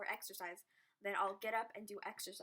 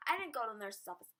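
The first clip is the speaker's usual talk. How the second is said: usual speaking voice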